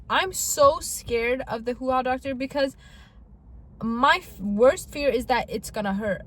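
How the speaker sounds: normal talking voice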